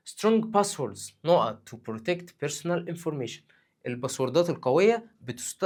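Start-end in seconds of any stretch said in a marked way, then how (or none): none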